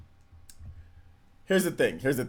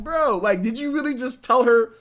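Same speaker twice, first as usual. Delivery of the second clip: incredulous bro; 9 semitones higher, 5 dB louder than usual. Retold or imitated. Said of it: "And I'm like".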